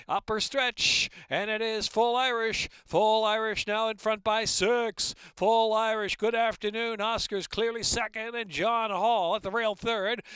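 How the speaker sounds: rapid race call building to a loud, high finish; live race call. Real, not acted. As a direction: call the race, rising to a roaring home stretch.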